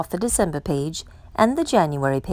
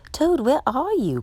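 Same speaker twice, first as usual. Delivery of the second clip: calling out; 7 semitones higher than usual. A narrator's reading. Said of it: "called Frog".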